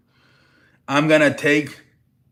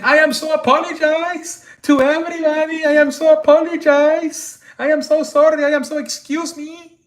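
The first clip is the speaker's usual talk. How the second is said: much higher, louder mock Turkish accent; 13 semitones higher, 4 dB louder than usual. Imitating the Turkish streamer apologizing to store staff, high mock Turkish accent. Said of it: "so he does an accent".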